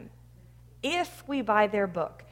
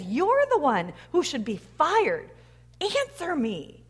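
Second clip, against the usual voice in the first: high, angry accusation at God; acted anger; 4 semitones higher, 3 dB louder than usual. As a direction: act out an angry demand to heaven. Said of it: "really angry".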